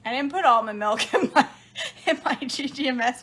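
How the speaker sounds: loud, laughing through words. Real, not acted. Realising she forgot almond milk, laughing. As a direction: laugh at your own slip.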